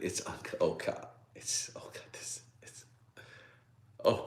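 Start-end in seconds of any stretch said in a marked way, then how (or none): 0.0-2.8 fading into an unvoiced whisper; real speechlessness, words trailing into breath; overcome whisper. Real, not acted. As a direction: whisper haltingly, too moved to finish. Preacher overcome by a realization.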